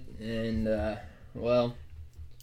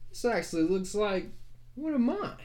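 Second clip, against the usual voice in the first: put-on high, creaky old man voice; old prospector; 8 semitones higher than usual. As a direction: peer at it like an old codger. Old mapmaker examines map.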